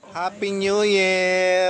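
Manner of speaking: drawn-out, high, loud cheer; celebratory call. Real, not acted. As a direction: call it out slowly to everyone. Greeting at midnight.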